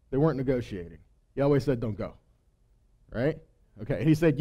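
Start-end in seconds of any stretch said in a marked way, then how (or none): 1.8-2.1 much lower and softer, firm; low quiet command. Retold or imitated. God telling Balaam in a low hush not to go. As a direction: drop it low and hushed.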